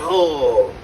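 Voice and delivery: mock constipated, strained groan; drawn-out caricature grunt, as if straining. Comic mimics how he claims they sound, constipated groan. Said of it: "I didn't sound constipated".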